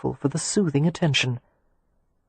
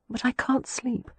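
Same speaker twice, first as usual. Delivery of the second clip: whisper; 8 semitones higher, 7 dB quieter than usual. A narrator's reading. Exhausted woman in bed at night.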